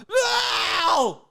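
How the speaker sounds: loud high drawn-out mock yowl; hammed-up scream of pain; imitated pained howl. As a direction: howl like a bad actor getting hurt. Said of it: "somebody literally goes like".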